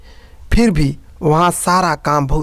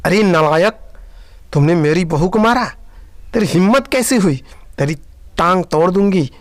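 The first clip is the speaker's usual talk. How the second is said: furious scolding; 3 semitones higher than usual. Acted. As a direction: tear into her son for the slap. सास बेटे पर भड़की. (मां का बेटे को गुस्से में ऊंची आवाज़ में डांटना)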